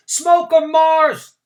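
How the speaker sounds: high, loud cheering shout; called out. A narrator's reading. Another spectator cheering Mars Bar, loud shout. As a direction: egg the runner on. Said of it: "someone else called out".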